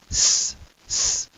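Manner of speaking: unvoiced hissed S, no vowel; pure hissing S; hissed S sound. Shown as a demonstration. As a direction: hiss the S alone. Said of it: "letters with the same sound".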